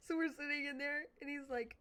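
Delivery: ordinary delivery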